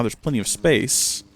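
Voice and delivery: normal talking voice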